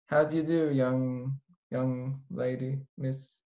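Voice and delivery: slow, genteel English gent greeting; British accent. Pretend. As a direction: drawl out a posh British hello. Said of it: "I will do my British".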